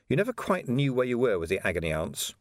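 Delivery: usual speaking voice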